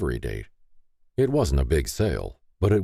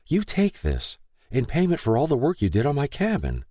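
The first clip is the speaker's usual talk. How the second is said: much higher, insistent woman's voice; urging young woman; 10 semitones higher than usual. A narrator's reading. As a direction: press the key on him kindly. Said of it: "Lay urged".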